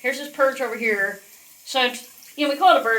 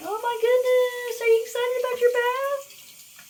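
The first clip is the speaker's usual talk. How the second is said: high pet talk; 12 semitones higher than usual. Real, not acted. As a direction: stretch it up high for the bird. Cooing to her parrot.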